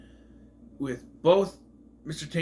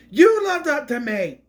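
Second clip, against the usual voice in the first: mock offended cat; 11 semitones higher, 8 dB louder than usual.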